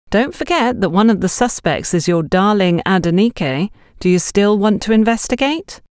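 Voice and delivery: a touch of mock challenge to the boys; joking. A narrator's reading. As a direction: tease them with a playful dare. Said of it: "Eloise asked jokingly".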